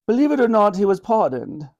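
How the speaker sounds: high-pitched, regal and wry; a wry smile in the voice; wry Queen. A narrator's reading. Queen revealing a surprise to Ben, wryly. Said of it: "said the Queen with a wry smile".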